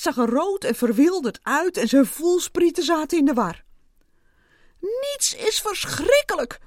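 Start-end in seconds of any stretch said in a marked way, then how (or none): 4.8-6.6 high-pitched frantic cry; screaming. A narrator's reading. Ant screaming that nothing is terrible, frantic. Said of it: "schreeuwde hij".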